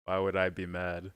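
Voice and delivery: ordinary delivery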